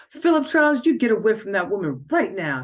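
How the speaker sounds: high, loud scolding command; furious demand. A narrator's reading. Jealous woman orders Philip away from the girl, furious. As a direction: order him off her, furious. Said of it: "she demanded".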